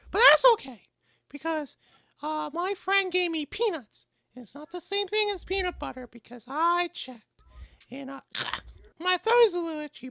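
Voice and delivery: very high put-on little child; kid voice. Shown as a demonstration. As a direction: talk like a small kid, high and halting. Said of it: "I have to do my kid voice".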